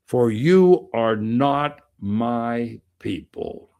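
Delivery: for you are not my people, slowed and weighted on you; slow, heavy emphasis. Real, not acted. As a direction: let each word land hard. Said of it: "that comes really strong".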